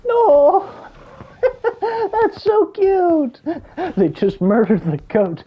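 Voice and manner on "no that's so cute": high-pitched squeal breaking into a laugh, the last words slow; delighted squeal. Real, not acted. Player squeals at a cute dinosaur animation, high and laughing. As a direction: gush over it, much higher and laughing.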